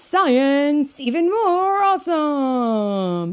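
loud, high, slow arena hype; announcer call. Acted. The announcer introduces Rocket Racer to the stadium, loud and slow. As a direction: draw out the name for the crowd. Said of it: "The announcer continued".

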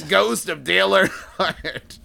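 slow booming announcer hype; monster truck ad. Pretend. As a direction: boom it out like a rally ad.

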